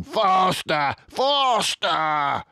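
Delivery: high, urgent farmer yell; Boggis shouting. A narrator's reading. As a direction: drive the men on like a maniac.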